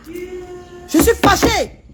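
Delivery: high, drawn-out angry words; slow angry declaration. Real, not acted. Queen telling Fred she is angry, drawn out slowly. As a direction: stretch out each word of your anger.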